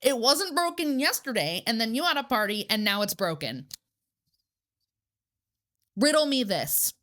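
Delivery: sarcastic grilling of Christopher over the broken arm; retold angry lecture. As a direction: grill him like a prosecutor, outraged. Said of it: "And I was like".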